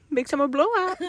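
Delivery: Oaken voice. Retold or imitated. Quoting Frozen shop owner.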